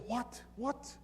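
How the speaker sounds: very high-pitched baffled double take; retold bewilderment. Boss not grasping the translated question, high bewildered voice. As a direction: imitate someone who cannot take it in. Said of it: "He can't understand what's going on now".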